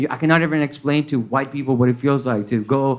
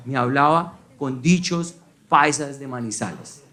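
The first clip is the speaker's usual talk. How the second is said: ordinary talk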